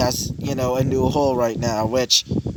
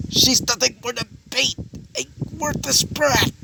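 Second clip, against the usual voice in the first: high-pitched, spiteful villain retort; scornful outburst; 10 semitones higher than usual. Acted. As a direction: spit the insult out in a raised pitch. Villain scorns the girl when questioned.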